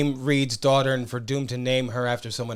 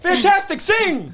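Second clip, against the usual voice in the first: very high, loud call; mock announcer cry; 4 dB louder, slower than usual. Co-host breaks in on the riff, high loud call. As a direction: belt out the cue. Calling out a shouted cue.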